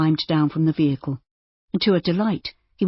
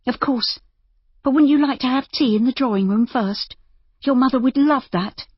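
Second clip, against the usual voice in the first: put-on higher, coaxing young lady voice; young woman voice; 8 semitones higher than usual. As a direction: persuade him brightly and gently. Narrator voices Judy.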